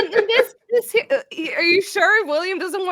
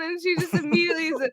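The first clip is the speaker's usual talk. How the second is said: ordinary talk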